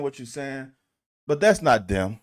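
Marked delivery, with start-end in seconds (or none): 1.3-2.2 firm rebuttal. Real, not acted. Arguing back at coach.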